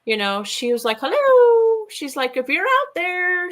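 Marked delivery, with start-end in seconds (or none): 1.0-1.9 high, loud, drawn-out call; singsong holler into the woods; llamada a lo lejos. Retold or imitated. Calling into the swamp, retold. 2.4-3.5 imitating a slow, high singsong call; llamada al bosque. Calling to unseen beings, retold.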